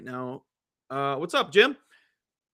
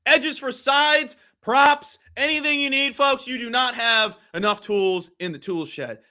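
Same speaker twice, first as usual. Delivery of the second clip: energetic, louder site plug; promo read; 4 semitones higher, 6 dB louder than usual. Acted. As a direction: punch up the sales pitch to the listeners. Promotes the show's betting site.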